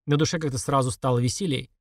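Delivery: ordinary delivery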